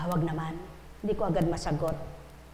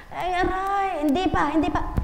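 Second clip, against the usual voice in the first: pained protest; 11 semitones higher, 5 dB louder than usual. Retold or imitated. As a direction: imitate a slapped patient crying out. Acts out slapped patient protesting. (kunwaring dumadaing at tumututol)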